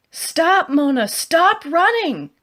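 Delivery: high, loud, drawn-out yell; desperate shout. Acted. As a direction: scream after her to stop. Shouting at fleeing friend.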